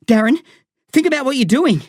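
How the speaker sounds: high, pleading beg; frightened boy begging his friend. Acted. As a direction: plead with him to stop. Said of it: "I begged".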